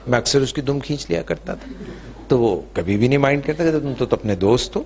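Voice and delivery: ordinary delivery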